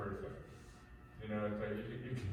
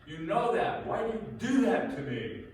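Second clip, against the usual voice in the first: whiny victim; 9 semitones higher, 8 dB louder than usual. Retold or imitated. Abuser playing victim to wife.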